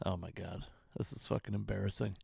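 low, soft, embarrassed aside; embarrassed mutter. Real, not acted. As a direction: mutter it under your breath. Dog ignores him, muttering.

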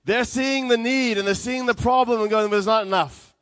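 loud, high, impassioned sermon delivery; forceful preaching. Real, not acted.

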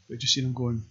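ordinary delivery

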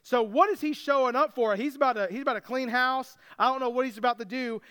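ordinary talk, nothing marked

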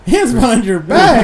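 incredulous question through laughter; laughing disbelief. Real, not acted. Friend laughs at a story of hands behind backs.

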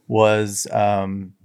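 ordinary talk

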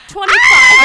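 high, loud shriek; shocked shout. Real, not acted. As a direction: shriek it in mock horror. Dreading her birthday.

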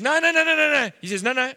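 high, loud string of rapid protests; hammed-up flustered protesting; flustered denial. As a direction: act out a panicky, insistent no.

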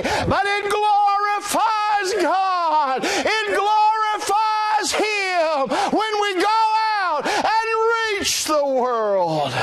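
rising sermon shout, drawn out; preaching at full force. Real, not acted. Preacher to congregation, full-force shout.

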